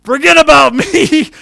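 high, loud, drawn-out yell; urgent retold shout. Roper on the ground to his helper, urgent yell. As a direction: imitate a friend shouting from the ground. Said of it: "all of a sudden he goes".

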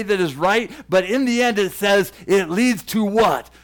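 loud, driving sermon voice; full-force preaching. Acted. Preacher to listeners, loud and driving. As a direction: build to the question like a revival preacher.

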